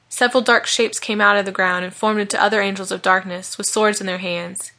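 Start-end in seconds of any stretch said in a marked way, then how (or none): none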